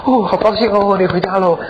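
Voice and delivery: high-pitched show of gladness; playful pretend cheer; mock delight. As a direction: act pleased at a homecoming. Wife acting glad he is home.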